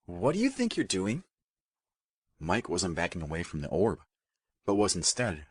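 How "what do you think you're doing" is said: warning. A narrator's reading. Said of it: "a warning clear in her tone".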